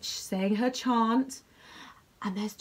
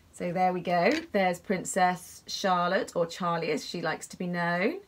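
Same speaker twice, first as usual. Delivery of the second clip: plain reading voice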